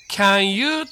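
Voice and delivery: "can you" as separate "kya-n yuu", no n-link; loud, split "can; unlinked mispronunciation. Shown as a demonstration. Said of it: "これは間違いですので".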